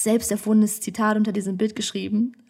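ordinary talk, nothing marked

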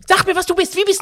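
very high, loud questioning; aggressive demand. Real, not acted. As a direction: demand an answer at full pitch. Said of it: "Bennys Aggressionsproblem". (aggressives, lautes Nachhaken)

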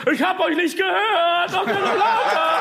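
high, slow, shouted crowd warm-up; holiday entertainer hype. Retold or imitated. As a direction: pump up the crowd like a resort animator. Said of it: "Wie so Animateure, Digga, auf Antalya da".

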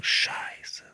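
whispered, almost soundless; stunned whisper. Retold or imitated. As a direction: breathe it out in disbelief. Stunned in the car. (fassungslos geflüstert)

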